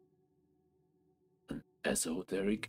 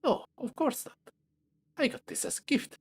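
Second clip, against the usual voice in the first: high character voice; 4 dB louder than usual. Acted. NPC refuses in high voice.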